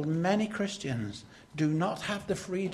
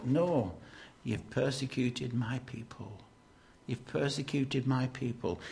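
solemn rebuke; 5 semitones lower, 7 dB quieter than usual. Acted. Jesus answers Saul, low and grave. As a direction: lower and softer, grave correction.

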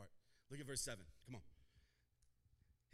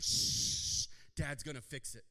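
loud shush then reassurance; calm the kids down; mock soothing mom; hushing parent; 11 dB louder, slower than usual. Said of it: "You're like".

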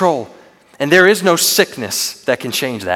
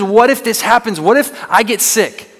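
high, anxious, rushing worry; raised, panicky inner voice; fearful what-ifs; 6 semitones higher, 3 dB louder than usual. Retold or imitated. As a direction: voice the spiraling fear in your head. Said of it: "It says".

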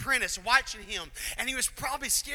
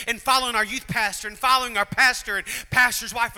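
preaching at full force; 2 semitones higher, 8 dB louder than usual. Real, not acted. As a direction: drive the point home to the crowd.

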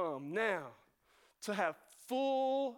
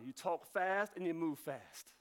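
ordinary talk, nothing marked

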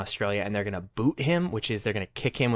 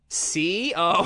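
"C O" letter by letter, higher; exaggeratedly patient spelling of "C O", raised pitch; retold spelling out; 8 semitones higher, 3 dB louder than usual. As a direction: stretch each letter of "C O" separately. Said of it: "And then she goes".

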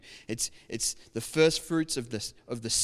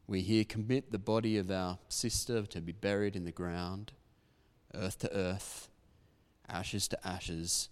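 hushed graveside prayer; 4 semitones lower than usual. Shown as a demonstration. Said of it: "the prayer that I pray to finish the service".

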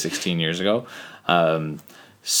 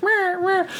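put-on baby fussing. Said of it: "just like".

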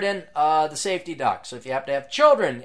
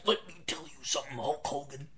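soft, lightly voiced wrestler growl; a hint of a wrestling promo; Macho Man Randy Savage; 9 dB quieter than usual. Imitating a wrestler calling out Hulk Hogan, a quiet growl. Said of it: "It could be like Macho Man Randy Savage".

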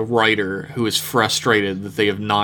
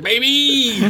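put-on hyped "baby"; 13 semitones higher than usual. George Lucas returns hype.

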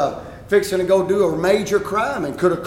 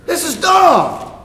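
exasperated shout; 9 semitones higher, slower than usual. Retold or imitated. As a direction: stretch it out, fed up. Mocking his own irritation.

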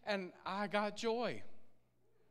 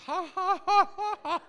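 forced ha ha, much louder and higher; deliberate laugh; 11 semitones higher, 10 dB louder than usual. Pretend. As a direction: choose to laugh out loud. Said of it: "I'm gonna laugh".

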